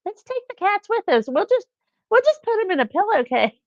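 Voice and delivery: high-pitched mock-chirpy suggestion; breezy family member. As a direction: imitate someone casually pitching a silly plan. Voices the family's breezy bad idea.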